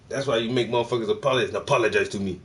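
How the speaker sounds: normal talking voice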